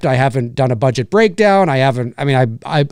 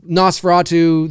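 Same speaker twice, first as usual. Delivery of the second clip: ordinary talk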